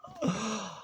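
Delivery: short high laugh in disbelief; disbelieving laugh. Real, not acted. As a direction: laugh at how absurd it is.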